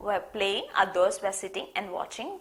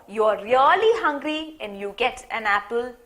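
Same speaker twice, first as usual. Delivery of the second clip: usual speaking voice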